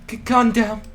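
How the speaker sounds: normal talking voice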